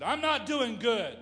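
loud, high, drawn-out mock complaint; high-pitched retold outburst; angry woman imitated. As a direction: act out her upset, slowly and loudly. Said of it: "this young lady was so angry".

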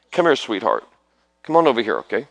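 ordinary talk

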